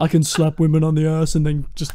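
low mock-villain boast; Thanos. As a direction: carry on the deep supervillain parody. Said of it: "Like he's Thanos".

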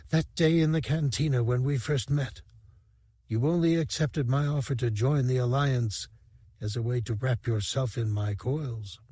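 a hint of a fragile alien rebel, gently reproachful; dying lamproid lover. A narrator's reading. Dice's voice addressing Sivrak.

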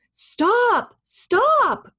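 high, urgent storybook shout; two sharp calls, pitch leaping up; crying out. A narrator's reading. Townspeople chase the gingerbread man, crying out. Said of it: "cried the townspeople".

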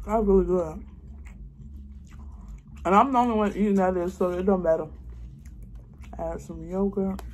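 chewing while talking; speaking between bites; mouth full. Real, not acted. Seen 1: Face clear; between-word mouth movements look like chewing during eating video.

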